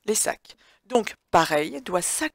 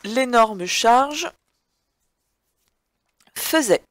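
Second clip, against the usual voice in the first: very slow, word-by-word dictation; dictating; 4 semitones higher, 4 dB louder, much slower than usual. Shown as a demonstration. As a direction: spell it out slowly for pupils. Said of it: "dictée read to the class".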